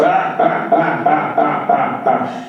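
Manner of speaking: big laugh in separate, repeated bursts; big acted laugh. A narrator's reading. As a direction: let out a long, staccato "ha ha ha". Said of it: "he just lets out this big laugh".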